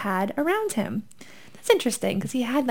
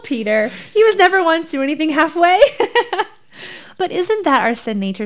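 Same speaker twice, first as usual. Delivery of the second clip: normal talking voice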